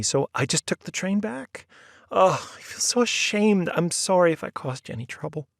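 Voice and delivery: put-on high, ashamed young woman's voice; Sally's confession. A narrator's reading. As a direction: confess it sheepishly, in a rush. Sally's held character voice.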